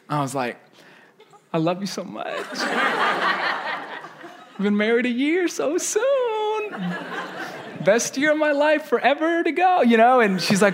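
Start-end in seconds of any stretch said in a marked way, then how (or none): 1.5-9.8 husband laughing nervously as he sweet-talks his wife; buttering up. Retold or imitated. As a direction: sweet-talk her before the bad news. Buttering up wife before bad news.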